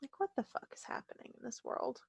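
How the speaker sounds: hushed, breath-only exclamation; whispered disbelief. Real, not acted. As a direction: whisper it in bewilderment. Disbelief at the match results.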